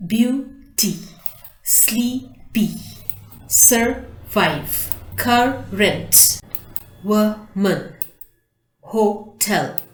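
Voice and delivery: each word broken in two, a gap between syllables; "Beauty" as beau, ty and "hotel" as ho, tel; split into syllables. Shown as a demonstration. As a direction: sound out "sleepy", "survive", "current", "woman" syllable by syllable. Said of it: "Underline their syllables and pronounce them".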